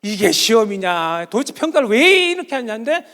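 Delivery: imitated exasperated protest, stretched out; retold protest. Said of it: "또 평가원 홈페이지가 박살났어요".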